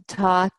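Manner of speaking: Thoth as "taht", t at both ends; hard-t variant. Shown as a demonstration. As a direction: say Thoth with an ah vowel and hard t sounds. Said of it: "many different ways of pronouncing his name".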